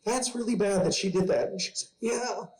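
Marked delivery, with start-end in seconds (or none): 0.0-1.5 mock parental dismay. Dad consoling daughter over friend's swearing, mock dismay. 2.0-2.5 very high-pitched child imitation; upset little girl confessing. Daughter confessing to dad about imaginary friend, upset child voice. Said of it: "she came to me crying".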